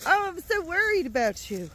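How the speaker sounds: usual speaking voice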